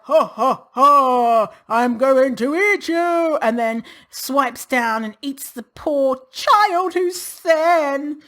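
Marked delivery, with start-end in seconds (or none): 0.0-3.4 slow hammed-up villain laugh and threat; mock monster. Imagined fat monster gloating over its victim in a slow mock villain voice. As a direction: ham up a giant monster gloating. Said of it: "fat Michelin person's like".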